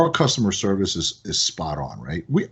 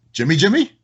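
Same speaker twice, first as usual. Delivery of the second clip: surprised recognition; 7 semitones higher, 4 dB louder, faster than usual. Retold or imitated. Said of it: "She goes".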